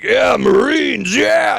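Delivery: slow, high rowdy whoop; Marine bar cheer. Retold or imitated. Mimicking Marines at a bar who cheer for themselves, a slow rising whoop.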